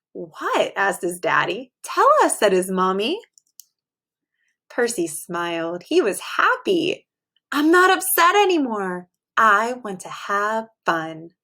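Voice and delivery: expressive bedtime story read; reading to children. A narrator's reading. Teacher reading a picture book to her class, storytelling voice. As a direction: read it aloud to a class of little kids.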